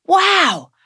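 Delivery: high, gasped wow of amazement; boy gasping wow at flat dough; amazed gasp. A narrator's reading. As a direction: gasp it out in delight. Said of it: "gasps Lorenzo".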